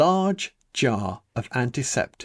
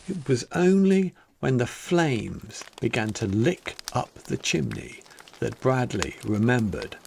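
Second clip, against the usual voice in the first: plain reading voice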